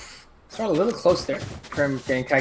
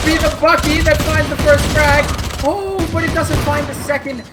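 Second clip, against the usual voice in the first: rising hype, higher and louder; excited casting; 10 semitones higher, 8 dB louder than usual. Real, not acted. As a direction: call the frag with a jolt of energy. Caster hyping a frag.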